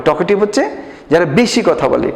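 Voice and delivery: ordinary talk, nothing marked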